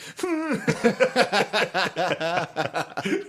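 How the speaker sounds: high, helpless cackling fit; imitated uncontrollable laughter. A student cracking up at mass, imitated uncontrollable laughter. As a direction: mimic losing it completely with laughter. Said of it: "laughing uncontrollably".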